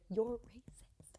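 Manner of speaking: slow whispered accusation; exaggerated silent-style call-out; mouthed whisper. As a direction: whisper it slowly as if mouthing from afar. Mimes accusation down the escalator.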